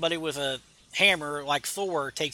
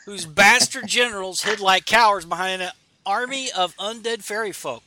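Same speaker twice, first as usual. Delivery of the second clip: grand Odin; 5 semitones higher, 7 dB louder than usual. Acted. Reading Odin's grand comic speech.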